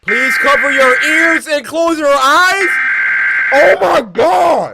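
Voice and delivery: high, loud, slow panicked cry; panicked yell. Real, not acted. Reading game text, panicking.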